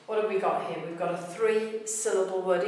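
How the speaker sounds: ordinary talk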